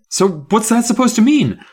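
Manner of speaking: high, loud indignant question; crying out. A narrator's reading. Gary demanding Miss Carmen explain, crying out indignantly. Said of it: "I cried".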